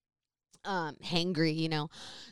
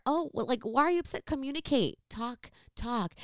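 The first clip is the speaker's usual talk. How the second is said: calm condescension; 5 semitones higher than usual. Retold or imitated. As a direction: imitate yourself coolly goading someone upset. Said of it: "I'm very calm and collected".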